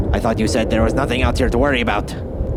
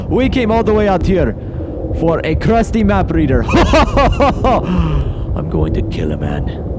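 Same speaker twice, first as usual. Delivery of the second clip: bitter laugh then anger; 7 semitones higher, slower than usual. Acted. Captain furious at the worthless find, bitter laughing rage. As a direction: laugh in disgust, then threaten.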